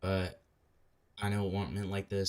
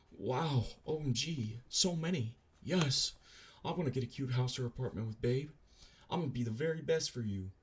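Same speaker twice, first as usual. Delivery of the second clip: put-on higher-pitched gushy girlfriend voice; mock gushing girlfriend; 5 semitones higher than usual. Streamer reading girlfriend's texts, mock gushing. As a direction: gush like an excited girlfriend, pitched up.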